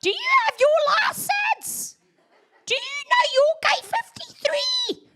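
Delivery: high mock interrogation; mocking angry driver. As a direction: sneer it like a pushy know-it-all. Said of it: "I was like".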